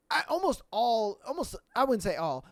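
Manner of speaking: ordinary talk, nothing marked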